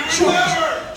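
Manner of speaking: loud high call. Real, not acted. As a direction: call his name across the room. Calling on a friend.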